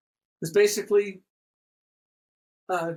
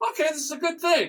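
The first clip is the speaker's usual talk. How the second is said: higher, fast surprised approval; quick mock realization; 10 semitones higher, faster than usual. Retelling his surprise at how easy Chrome was, fast and high. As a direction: snap to a quick delighted okay. Quoting himself after installing Chrome.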